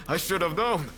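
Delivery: relieved laugh running into the words; breathy relieved laugh, then speak through it. Acted.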